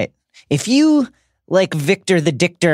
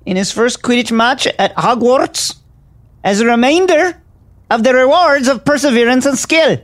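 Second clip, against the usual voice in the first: louder, higher formal proclamation; formal reading; 6 semitones higher, 4 dB louder than usual. Acted. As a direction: proclaim it like a minister. Reading the will, building.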